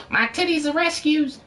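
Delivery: very high, squeaky joke voice; mock high-pitched proclamation; silly put-on voice. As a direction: pipe it up high like a cartoon. Blurts a joke line in funny voice.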